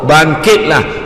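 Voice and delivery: loud, drawn-out call; divine summons. Retold or imitated. God telling the prostrate servants to rise, loud summoning voice. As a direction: call them up with authority. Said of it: "Allah Ta'ala kata".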